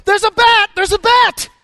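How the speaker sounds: high, loud panicked shriek; retold scream. Wife spotting a bat in the crowd, shrieking in panic. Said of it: "my wife screams".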